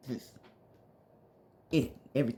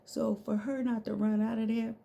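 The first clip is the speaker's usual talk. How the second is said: ordinary delivery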